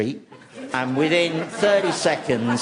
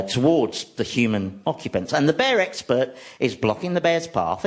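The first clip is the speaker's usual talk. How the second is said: normal talking voice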